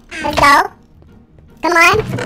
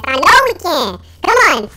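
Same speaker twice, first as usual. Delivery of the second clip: usual speaking voice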